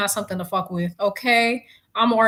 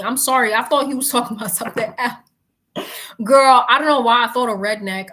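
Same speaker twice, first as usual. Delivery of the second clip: ordinary talk, nothing marked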